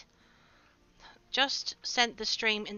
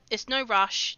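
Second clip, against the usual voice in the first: ordinary talk, nothing marked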